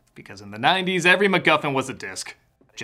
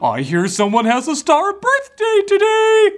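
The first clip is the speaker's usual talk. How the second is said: slow, high, loud host greeting; cheesy mascot voice; 5 dB louder, slower than usual. Pretend. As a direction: welcome the kids like a mascot. Mock kids restaurant greeting.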